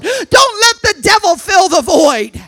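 loud, high-pitched preacher's shout; full-voiced pulpit exhortation; shouted warning. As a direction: cry it out as a fierce warning.

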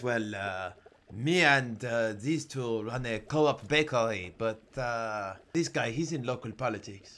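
put-on comic French-accented goblin. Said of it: "least racist accent I could do".

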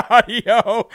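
breaking into a real laugh mid-word; cracking up while asking for the clip. Real, not acted. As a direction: laugh through the last word. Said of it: "I laugh so hard".

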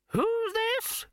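put-on high, quavering old wizard; creaky doddering old man, high pitched. Poons voice.

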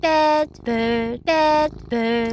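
high parroting mimicry; smug mocking echo; smirking taunt. A narrator's reading. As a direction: gloat in a birdie squawk.

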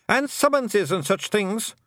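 high, slow Scottish wee man, worried; Feagle voice. A narrator's reading. A Feagle warning Tiffany about documents, high Scottish fret. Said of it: "said another".

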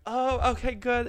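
high-pitched imitation of relieved strangers; mock relief. Strangers learn the shirt is merch, high mock relief.

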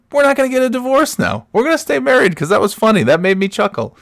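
high playful announcement; high mock reassurance. Dad telling the kid no divorce, high playful. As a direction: proclaim it up high like good news.

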